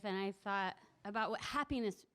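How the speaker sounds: ordinary talk, nothing marked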